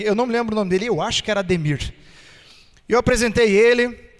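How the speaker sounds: usual speaking voice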